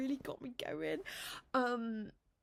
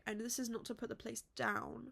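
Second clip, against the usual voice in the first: normal talking voice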